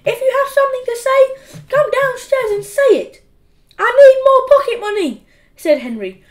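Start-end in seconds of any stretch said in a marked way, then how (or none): 0.0-3.2 high, loud and firm; exasperated mother shouting to her son upstairs. Acted. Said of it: "shouted his mum". 3.8-5.2 loud demand. Acted. Henry demands money from mum.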